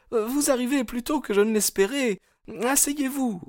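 very high, anxious female voice; panicked politeness in a high-pitched voice; flustered young woman. A narrator's reading. Hetty greeting the suitor who arrives early, flustered. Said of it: "affolée".

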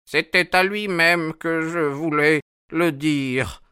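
put-on high, drawn-out old man's voice; high-pitched cranky elder; stubborn old sailor. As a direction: insist like an obstinate old mariner. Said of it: "d'un respectable maître marinier".

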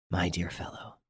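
soft exasperated whisper. A narrator's reading. Lupin seizing his friend's arm, exasperated whisper. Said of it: "in a tone of exasperation".

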